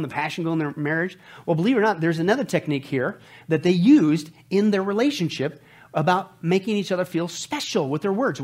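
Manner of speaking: ordinary delivery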